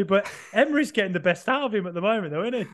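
ordinary talk, nothing marked

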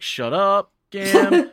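high, loud, rising shut-up; hammed-up rebuke; mock snap. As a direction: bark it playfully. Spooky snaps at Gam.

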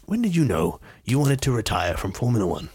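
chat-show interviewer with swooping intonation; Michael Parkinson. Acted. Imitating interviewer asking retired driver, put-on voice. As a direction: put on a veteran interviewer's measured question. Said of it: "Michael Parkinson went".

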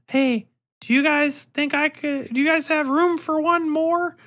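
put-on high, hopeful little-girl voice; shy girl's voice. Orphan girl asks group home kids to join, high and hopeful. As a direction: ask timidly to join in. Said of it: "she's like".

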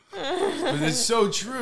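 high, slow, through laughter; laughing. Real, not acted. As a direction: laugh the words out. Laughing after the street-show bit.

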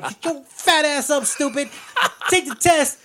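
high, hammed-up angry telling-off; mock scolding. Girl smacking a sleeping classmate awake, mock scolding.

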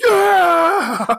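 high, loud mock yell; roaring crowd cheer. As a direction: whoop like the dudes in the crowd. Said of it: "all the dudes in the crowd are just like".

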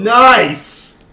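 high, booming whoop of approval; loud cheer. Real, not acted. Friend hearing a woman is coming, loud teasing cheer. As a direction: shout it out, drawn long. Teasing about a female visitor.

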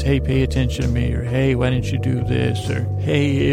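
ordinary talk

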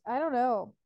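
usual speaking voice

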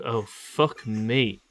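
ordinary talk, nothing marked

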